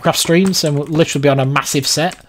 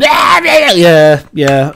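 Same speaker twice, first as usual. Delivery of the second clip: put-on kid cheering; 2 semitones higher, 4 dB louder, much slower than usual. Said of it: "you get the nine-year-old going".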